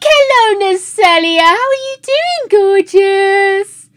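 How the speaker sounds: loud, high, gushing hello; excited greeting. Real, not acted. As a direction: call out to a friend who just arrived. Greeting a new viewer loudly.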